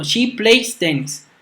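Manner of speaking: slow, careful classroom reading of the example; deliberate teacher demo pace; slow model sentence. Shown as a demonstration. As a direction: read it out slowly so students can follow. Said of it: "teacher models example sentence".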